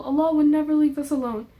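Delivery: normal talking voice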